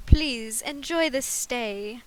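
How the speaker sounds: loud, slow attempt at a British accent; hammed-up British accent, opening loud and drawn out; guessed British accent. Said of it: "try and guess what the hell a British accent".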